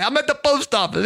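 high-pitched imitation of a friend's Italian accent; a quick mock Italian accent; lightly imitated Italian accent on a phone call. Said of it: "He's got this Italian accent".

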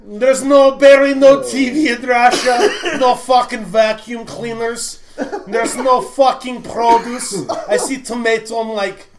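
put-on loud, high, slow Russian-accented rant; Russian roommate's rant. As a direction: rant in an exaggerated Russian accent, dragging the words out. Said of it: "And he goes".

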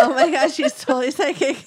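loud, laughing excited squeal; loud giddy disbelief, fast at the end. Retold or imitated. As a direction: burst out laughing in amazement. Retelling excited reaction to text.

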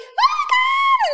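very high, loud, slow "Oh my God"; high-pitched squeal. Retold or imitated. Client shares hair extension news, she squeals very high. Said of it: "I got more and more high pitched".

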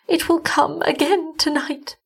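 high, thin-spirited feeble voice; weakened woman. A narrator's reading. Weak wife warning her husband, high and feeble. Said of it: "She was weaker now".

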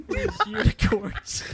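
high, slow, teasing repeat of These unicorns; high mock repeat. Friend mimics the kid's unicorn answer, high and teasing.

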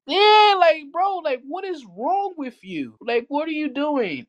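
very high, incredulous mock scolding; exasperated disbelief. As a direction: pitch it sky-high, baffled at him. Scolds the absent coworker in disbelief.

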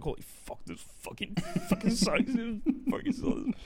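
high, strained mock whine; whiny complaining. A light imitation of himself griping to coworker, high whiny moan. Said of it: "I was like".

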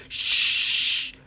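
long, forceful "shh"; loud shushing. Acted. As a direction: shush someone urgently. Everybody hushes the loud boy.

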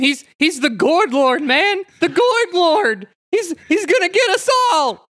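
very high, frightened wail; hammed-up cartoon coward in terror; panicked cartoon voice. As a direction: squeal a frantic warning, drawing it out. Player voices a frightened Shaggy.

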